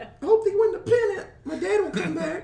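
put-on high, far child voice; kid voice. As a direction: play a hopeful little kid. Voicing the kid character.

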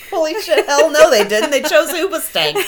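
loud, high, through real laughter; laughing outburst. Real, not acted. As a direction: burst out laughing as you talk. Cracking up at band name joke.

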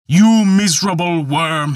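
high, loud roar of contempt; thundering voice. Acted. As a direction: boom it down at him. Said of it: "he cried in a voice of thunder".

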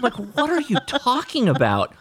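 high, exasperated disbelief; incredulous. Real, not acted. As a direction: throw up your hands in disbelief. Exasperated at game mechanics.

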